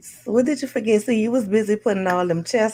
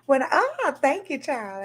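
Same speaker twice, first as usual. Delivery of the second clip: normal talking voice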